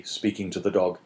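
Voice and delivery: plain reading voice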